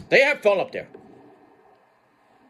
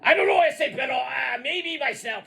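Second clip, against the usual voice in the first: [name]; 4 semitones higher than usual. Acted. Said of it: "[name], what are you dressing as again".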